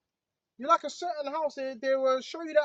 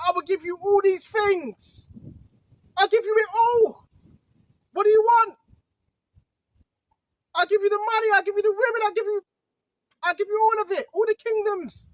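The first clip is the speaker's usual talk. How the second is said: loud, high, insistent offer; devil tempting; 6 semitones higher, 7 dB louder than usual. Retold or imitated. The devil tempting Yahushua with all these things, loud insistent offer. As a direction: push the offer hard, higher and louder. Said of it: "The devil said".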